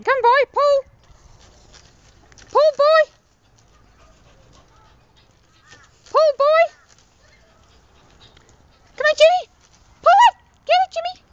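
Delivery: very high, loud urging calls; tug of war. Real, not acted. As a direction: cheer the dog on harder. Dog pulling the hose.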